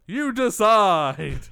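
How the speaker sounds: very slow, loud, high taunt; hammed-up evil sign-off; mock villain taunt.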